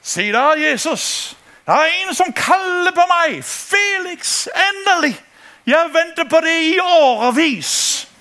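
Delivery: high, excited, playful voice of God; God delighted that Felix is finally calling. Acted. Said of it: "Og Gud siger".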